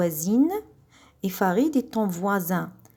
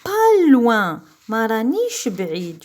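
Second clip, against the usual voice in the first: emphatic; 3 semitones higher, 6 dB louder, slower than usual. Real, not acted. Teacher stresses meaning.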